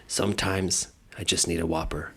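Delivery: low, soft gruff ad line; deep rugged lumberjack, hushed; lumberjack commercial voice. Retold or imitated. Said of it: "one guy turns to the other two and says".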